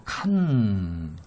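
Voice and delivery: 칸 stretched slowly with a held final n; syllable-by-syllable demo. Shown as a demonstration. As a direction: model 칸 as a long, isolated first syllable. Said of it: "그래서 conduit라고 하는데". (칸을 길게 늘여 또박또박)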